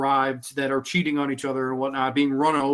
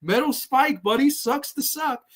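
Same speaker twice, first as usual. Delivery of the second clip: mocking Telly voice; 10 semitones higher than usual. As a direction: taunt the dead man with glee. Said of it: "He's just like".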